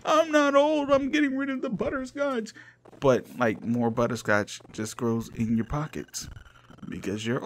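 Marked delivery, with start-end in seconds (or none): 0.0-2.5 much higher, squeaky protest; high put-on old man voice; squeaky old-timer voice. Old man denying being old.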